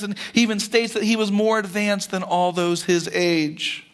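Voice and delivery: ordinary talk, nothing marked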